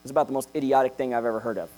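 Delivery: normal talking voice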